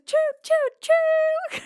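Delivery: high, loud, slow choo-choo hoots; playful whistle of a steam engine; train whistle imitation. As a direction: toot it like a toy train. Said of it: "Karamba says".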